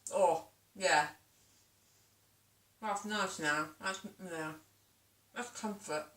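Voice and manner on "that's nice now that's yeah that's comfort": talking while chewing; words round a mouthful of cake; mouth full. Real, not acted. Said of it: "I'm talking with my mouth full".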